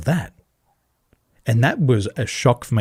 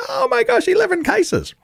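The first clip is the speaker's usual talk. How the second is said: much higher, hammed-up mock panic; mock meltdown. Mimicking locals melting down over eleven cases, mock panic. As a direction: squeal it like a panicking crowd. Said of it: "people are going to meltdown going".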